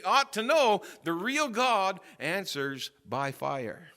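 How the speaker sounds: loud, high, sustained proclamation; full-force preaching. Real, not acted. Preacher proclaiming that the nation knows God, full-force preaching. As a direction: drive it home to the whole congregation.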